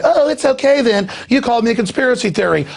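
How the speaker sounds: high, quick, mocking little-old-lady voice; mock gullible old lady. Host mocking the robbed old lady, high and silly. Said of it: "And she's like".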